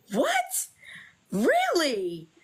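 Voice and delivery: high, shocked what really; woman told she's pregnant, shocked and high; stunned disbelief. Retold or imitated. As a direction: gasp out the question in disbelief. Hearing she is pregnant.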